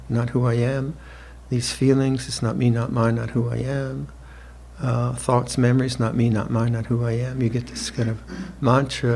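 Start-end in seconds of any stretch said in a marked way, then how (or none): none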